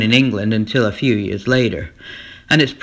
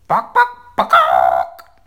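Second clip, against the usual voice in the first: chicken cluck; 12 semitones lower, 7 dB louder, much slower than usual. Acted. Chickens return.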